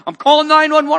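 loud, high threatening yell; retold yell. Alarmed bystander shouting at the pastor, loud high threat.

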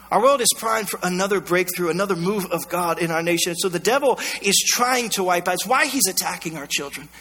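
normal talking voice

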